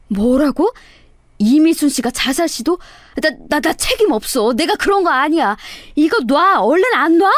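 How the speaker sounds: flustered, stammering denial; a touch of alarm, scrambling to get free; acted panic. A narrator's reading. Cornered swindler denying responsibility, flustered acted panic.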